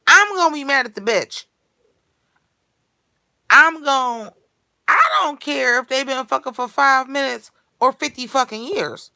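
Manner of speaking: worked-up, forceful protest; heated rant. Real, not acted. Heated hypothetical about cheating.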